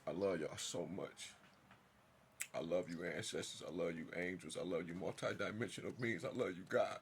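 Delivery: soft, sincere, near prayer; tender thanks. Real, not acted. Speaking softly to ancestors and God in grateful, tender prayer. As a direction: say it gently, like a quiet prayer of love.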